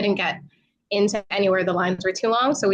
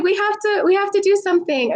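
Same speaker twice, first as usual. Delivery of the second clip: urgent pleading; 10 semitones higher than usual. Retold or imitated. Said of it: "she was like".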